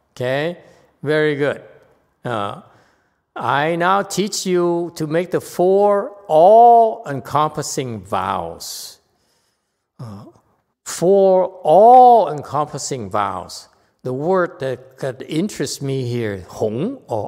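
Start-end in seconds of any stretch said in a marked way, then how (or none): 3.3-9.0 slow, loud, emphatic reading; deliberate recitation, dropping at the end; reading aloud. Real, not acted. Reads the text aloud. 10.8-13.7 slow phrase with "all-encompassing" swelling loud; big loud weight on "all-encompassing"; stress on all-encompassing. Real, not acted. Repeats phrase, emphasising key word.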